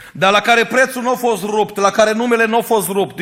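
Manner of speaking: high, loud, ringing preacher's cry; preaching at full force. Real, not acted. Preacher crying out to the congregation, high and loud. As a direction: lift the voice to the whole church.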